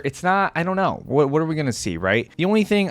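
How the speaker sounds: normal talking voice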